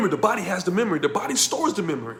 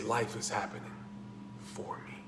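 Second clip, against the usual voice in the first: quiet, fading into a whisper; hushed, breathy surrender; soft realisation; 7 semitones lower, 17 dB quieter than usual. Shown as a demonstration. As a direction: let the words out softly, surrendering. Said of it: "when you break down and say".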